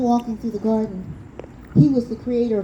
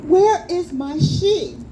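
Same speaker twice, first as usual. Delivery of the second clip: pleading son; 7 semitones higher, 5 dB louder, slower than usual. Acted. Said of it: "And he said".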